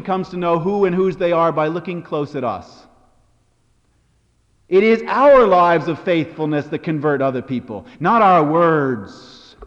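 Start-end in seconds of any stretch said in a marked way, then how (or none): 4.7-9.6 loud, forceful declaration, slowing on the last words; emphatic preaching. Real, not acted. Preacher to congregation on lives of faithfulness, emphatic preaching. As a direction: drive it home loud and deliberate. Preacher drives home central point.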